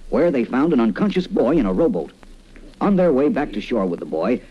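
normal talking voice

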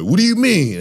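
high, loud indignant squeak of a question; mock the hurt friend's shocked reply; offended friend. Mimicking the friend who takes offense, high and loud. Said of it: "And then you be like".

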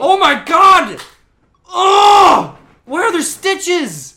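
high, very loud yell of disbelief; shocked shouting. Real, not acted. Friend aghast at stitches on a bared stomach, shocked shouting. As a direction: shriek it out, drawing the question out slowly.

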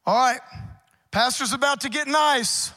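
loud, driving sermon delivery; preaching at full force. Acted. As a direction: preach it hard to the congregation.

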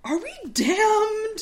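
a timid soldier asking about his soul; high slow fretful question; worried Mueller. Acted.

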